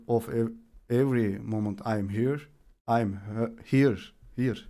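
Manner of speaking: reads the quote again quietly, minding every comma; careful soft reread. Shown as a demonstration. Redoing the quote for chat, soft and careful. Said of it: "virgüllere dikkat ederek oku".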